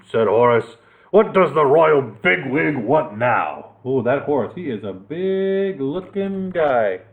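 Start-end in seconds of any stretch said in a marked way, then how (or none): 1.1-3.7 slow, grumpy knight voice; gruff knight. Acted. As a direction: scoff slowly at the king. Horace's gruff voice.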